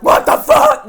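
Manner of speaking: very high, loud mock shriek; exasperated outburst. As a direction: blurt it out in hammed-up disbelief. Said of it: "other times I'm like".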